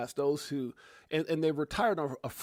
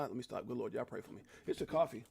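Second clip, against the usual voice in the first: usual speaking voice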